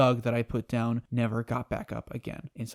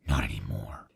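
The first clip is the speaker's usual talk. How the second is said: much lower, dark flat reply; cold villain. Retold or imitated. Savitar answering Iris, deep and cold. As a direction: drop it low and final. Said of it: "Savitar stops and thinks".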